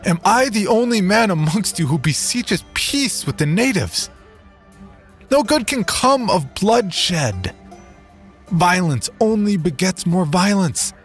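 high, strained plea over a noisy crowd. Acted. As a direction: beg them for peace over the din. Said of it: "spoke up over the din".

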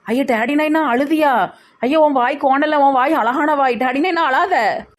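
high, drawn-out wail of concern; fussing consolation. Acted. Child begs Daddy Naina not to cry, fussing consolation. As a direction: fuss over him in a high voice. Fussing over crying father.

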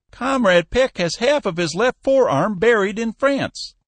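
put-on a hot-tempered old boss laying down the law; stern old Cappy. A narrator's reading. Cappy threatening Skinner over the price, high stern old man.

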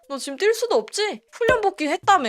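ordinary delivery

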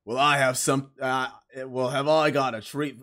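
scratchy imitation of a game merchant's accent; scratchy-voiced merchant, badly sustained; merchant impression. Shown as a demonstration. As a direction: put on the shopkeeper's scratchy accent, haltingly. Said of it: "Let me read it in his accent".

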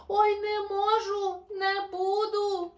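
high, loud and slow panicky wail; cling on and refuse in fear; scared koala crying out; frightened cry. Acted.

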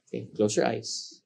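ordinary delivery

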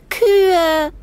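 put-on high, bright child's cry of delight; excited little boy. A narrator's reading. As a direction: shout it like a thrilled five-year-old. Kleiner Bruder ruft vergnügt. (hoher, vergnügter Kinderruf)

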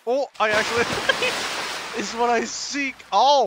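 ordinary talk, nothing marked

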